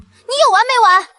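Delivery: high-pitched fed-up retort; exasperated protest. Acted. Woman fed up with her persistent visitor, exasperated. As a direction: snap at him, pitch jumping up.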